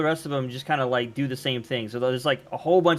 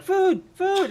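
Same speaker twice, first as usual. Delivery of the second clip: much higher begging critter voice; squeaky raccoon chorus; 4 dB louder than usual. Acted. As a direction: beg like a hungry little animal.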